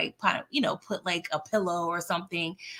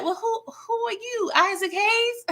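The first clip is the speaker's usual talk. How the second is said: put-on a high old-lady voice asking who he is; confused grandma; 13 semitones higher than usual. Grandma not recognizing her grandson, high old-lady voice. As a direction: squeak it out like a muddled granny.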